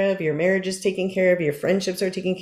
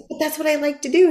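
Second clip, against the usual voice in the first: fast, much higher, mock-whiny; whiny protest; 10 semitones higher, faster than usual. Answering friend Amy, mock-whiny and fast. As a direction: plead like a kid defending a habit. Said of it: "And I'll say".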